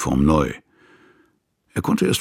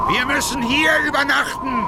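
shouting over the storm, higher; raised voice over a snowstorm; calling out. Acted.

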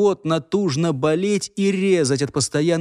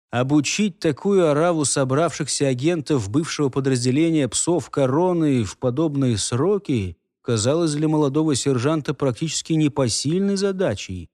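usual speaking voice

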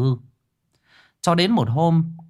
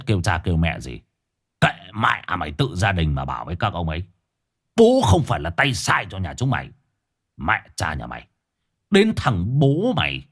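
menacing thug; 3 semitones lower than usual. Acted. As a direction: bark threats at the family. Thug threatens the frightened family.

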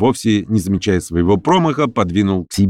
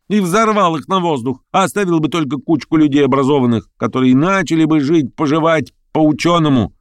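higher-pitched eager exclamation, no real shout; crying out; 9 semitones higher than usual. A narrator's reading. Lyamshin proposes an alternative to paradise, exclaiming. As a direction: exclaim it in a lifted pitch, mildly excited. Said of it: "вскричал Лямшин".

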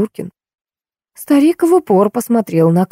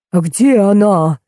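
put-on yelling old man; 3 semitones higher, slower than usual. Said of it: "заорал незнакомец".

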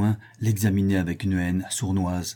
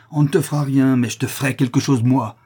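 raised, menacing voice; angry threat; 4 semitones higher, 5 dB louder than usual. Acted. Prisoner threatening the man who robbed him, angrily. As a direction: threaten with mounting anger. Acted attack on the bailiff.

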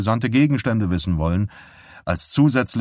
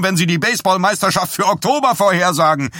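hoch, laut und schnell; wütend keifend; 10 semitones higher, 4 dB louder, faster than usual. A narrator's reading. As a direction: keift außer sich. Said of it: "Dies stotterte wütend". (schnelles, hohes Wutgekeife)

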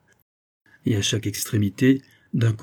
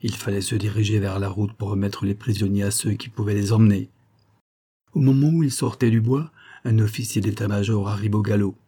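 usual speaking voice

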